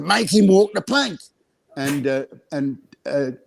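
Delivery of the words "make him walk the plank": Cockney accent. Shown as a demonstration. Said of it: "how do you say that".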